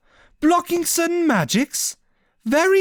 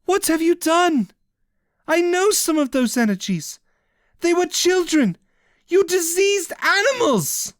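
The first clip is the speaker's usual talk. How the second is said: higher, building to an outraged shout; tearful shout. Acted. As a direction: horror turning into fury near tears. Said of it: "Ishel shouted".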